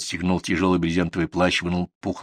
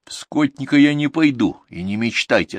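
raised, decisive rebuff; curt refusal; 7 semitones higher, 4 dB louder than usual. A narrator's reading. Viktor turns down the herdsman's job, curt refusal. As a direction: shut the offer down firmly. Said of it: "отрезал Виктор".

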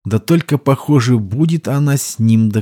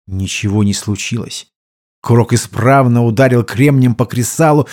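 usual speaking voice

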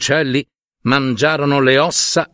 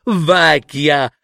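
high, sneering scorn; girl scoffing at the old woman over supper; scornful mockery; 5 semitones higher than usual. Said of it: "la ragazza scoppiò a ridere ancora".

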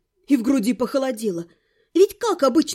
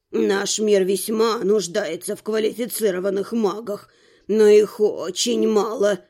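put-on self-important old man; 6 semitones lower than usual. A narrator's reading. Old man explains the worlds to a newcomer, self-important. Narrator voices the old rector.

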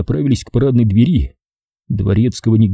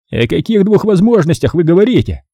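put-on higher curious young man; 8 semitones higher, 3 dB louder than usual. Edgar voice.

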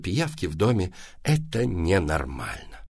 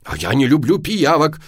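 loud, higher-pitched retort; shouting; 6 semitones higher, 6 dB louder than usual. A narrator's reading. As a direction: yell back indignantly. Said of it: "закричал папа".

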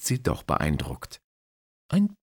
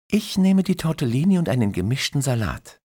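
put-on young woman voice; 10 semitones higher, 5 dB louder, faster than usual. Christine orders, narrator voices her.

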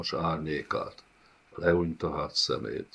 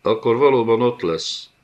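loud, higher booming pronouncement; rumbling boom; 5 semitones higher, 6 dB louder than usual. A narrator's reading. The Griff booms his decision to the servant. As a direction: let it rumble like thunder. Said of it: "dübörögte a Lidéc Griff".

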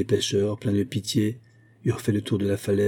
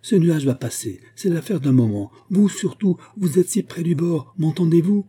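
raised, higher-pitched urgent call; 8 semitones higher, 4 dB louder than usual. A narrator's reading. Ladolphe to Ariane near the edge, calling out. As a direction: call anxiously through the fog.

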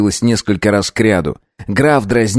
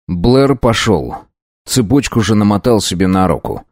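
plain reading voice

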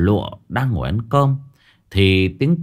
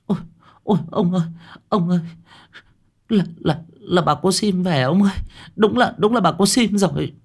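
high-pitched, stuttering alarm; frightened wife calling her husband, high voice; startled, stammering wife; 10 semitones higher, faster than usual. A narrator's reading. Wife startled by the madwoman's return.

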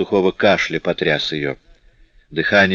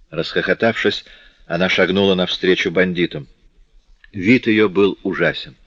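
plain reading voice

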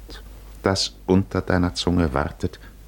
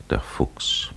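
whisper; 4 dB quieter than usual. A narrator's reading. Sly aside about Odysseus.

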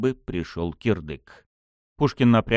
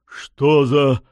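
loud, higher startled cry; astonished exclamation; 5 semitones higher, 6 dB louder than usual. A narrator's reading. Pushkin recoils from the opening rift, astonished. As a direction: exclaim in disbelief. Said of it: "изумлённо воскликнул Пушкин".

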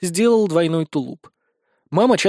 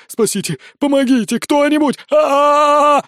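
high cries for help building to a scream; panicked screaming; 11 semitones higher, 6 dB louder, slower than usual. A narrator's reading. Wizard clings to leader over lava, panicked screaming. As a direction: escalate from a cry to a loud, high scream.

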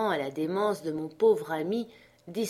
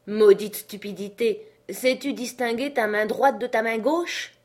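raised, mildly angry outburst; exasperated master; 4 semitones higher, 5 dB louder than usual. Acted. Master loses patience with Jupiter, exasperated. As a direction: snap with impatience.

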